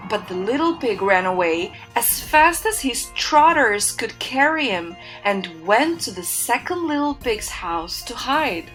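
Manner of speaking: ordinary delivery, nothing marked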